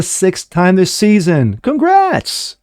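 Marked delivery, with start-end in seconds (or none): 1.6-2.6 high-pitched mock congratulations; sarcastic cheer. Host mocking a race winner, sarcastic cheer. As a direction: cheer it in an exaggerated, put-on high voice.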